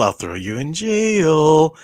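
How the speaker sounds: smug, stretched-out mock threat; sing-songy, smarmy. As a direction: tease it out in a smarmy singing lilt. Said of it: "Just that kinda sing-songy, smarmy".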